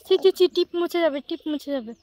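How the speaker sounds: high, loud tutting; scolding. Real, not acted. Baby smudging bindi.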